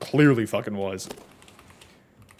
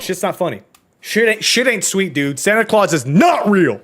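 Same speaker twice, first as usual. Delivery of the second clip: mock outburst; 7 semitones higher, 4 dB louder than usual. Mock-serious outburst about Santa.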